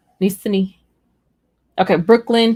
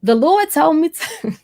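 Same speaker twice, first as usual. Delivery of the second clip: church mother; 7 semitones higher than usual. Retold or imitated. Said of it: "one of the mothers tell me".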